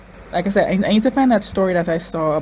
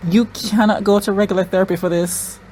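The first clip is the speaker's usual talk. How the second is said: ordinary delivery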